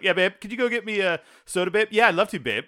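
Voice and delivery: exaggerated domestic back-and-forth; joking babe banter. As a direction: overdo the pet name for fun. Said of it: "jokingly call each other babe".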